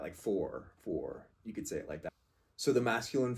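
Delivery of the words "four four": four said twice, falling, as a model; like the number four. Shown as a demonstration. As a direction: model the sound four for fort. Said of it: "you can think about it like that".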